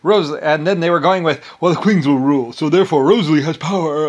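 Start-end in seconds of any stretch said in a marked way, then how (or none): 1.6-4.1 loud mocking parroting; loud, sing-song mimicry of opponents; mocking quote. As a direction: throw their argument back, loud and scornful. Mock-quotes rivals' argument.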